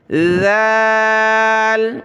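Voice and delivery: "ذال" softly begun with th, long vowel; tongue tip out, draw "ذال" out. Shown as a demonstration. Teacher repeats proper reading, "ذال" soft and long. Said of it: "نرمی ہے".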